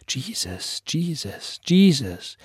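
distant, fading inner echo; a bell tolling inside his mind; echoing in his head. Acted. Reverend's thoughts echoing, distant and hollow. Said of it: "hallte es wieder und wieder durch seinen Kopf".

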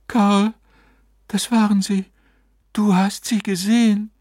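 high, wondering woman's voice; awestruck wife. A narrator's reading. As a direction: speak high, overcome by what appeared. Wife insists to husband, awed.

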